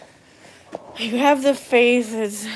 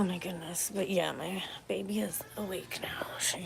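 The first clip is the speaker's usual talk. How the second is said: whisper; 4 semitones lower, 15 dB quieter than usual. Real, not acted. Baby just woke from nap.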